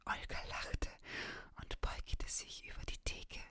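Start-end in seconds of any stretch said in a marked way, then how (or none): none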